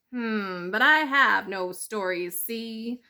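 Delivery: a lightly voiced sly weasel; gangster-ish weasel saying see. Weasel doubting the mouse, a hint of menace.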